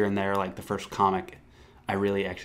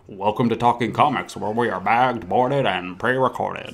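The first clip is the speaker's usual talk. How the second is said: put-on old-time newsreel announcer; punchy vintage radio reporter; 1950s reporter voice; 5 dB louder than usual. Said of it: "I sounded like a, a nineteen fifties, uh, reporter trying to get the scoop".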